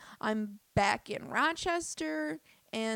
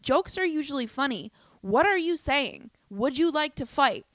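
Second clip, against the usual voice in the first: mock challenge; 4 semitones higher, 7 dB louder than usual. Comedian retelling how she challenged a woman to fight, a loud taunt. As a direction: square up and needle her with it.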